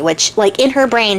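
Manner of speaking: plain reading voice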